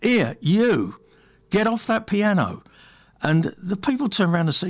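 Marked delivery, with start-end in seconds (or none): 0.0-2.6 half-hearted shout of an officious jobsworth; mild mock shout.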